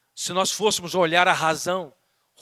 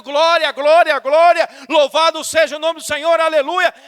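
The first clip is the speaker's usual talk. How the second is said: loud, high cries of praise; shouted praise; 8 semitones higher, 8 dB louder than usual. Acted. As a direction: shout praise as if alone in the car. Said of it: "Começa a gritar dentro do carro". (louvor gritado em voz alta)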